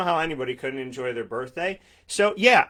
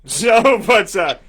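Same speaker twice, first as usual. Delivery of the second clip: laughing; 7 semitones higher, 9 dB louder, slower than usual. Real, not acted. Laughing after his joke.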